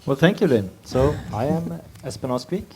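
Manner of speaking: ordinary talk, nothing marked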